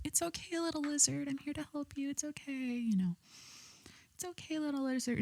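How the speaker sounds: high, soft cooing to a scared lizard; sweet baby talk. Retold or imitated. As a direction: coo gently like soothing a tiny pet. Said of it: "I was talking to him so sweet".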